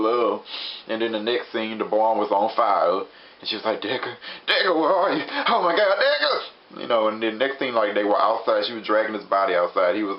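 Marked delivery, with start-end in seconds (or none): 3.8-6.8 mock-panicked cry, climbing higher and higher; frantic calling. Wife calls Decker at burning barn, frantic retold cry. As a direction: call out in rising alarm, play-acted.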